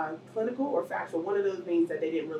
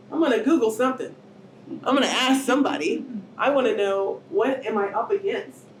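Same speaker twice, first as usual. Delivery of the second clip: usual speaking voice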